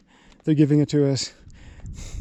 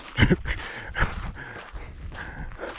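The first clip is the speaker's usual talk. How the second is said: wry laugh; 7 dB quieter than usual. Real, not acted. Wry at the uphill route.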